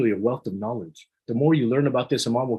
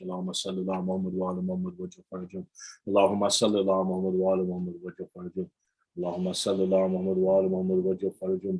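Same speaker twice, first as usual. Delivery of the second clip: quick, softer devotional recitation; recited salawat; 3 semitones lower, 3 dB quieter, faster than usual. Acted. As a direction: run the blessing off in one breath. Leads repeated salawat.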